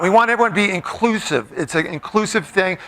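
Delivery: usual speaking voice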